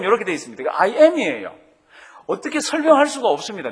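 ordinary delivery

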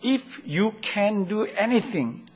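drawn-out English quote, very slow. Retold or imitated. Preacher quoting the father, drawn out slowly. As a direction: stretch every word of the plea.

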